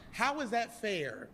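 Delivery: ordinary talk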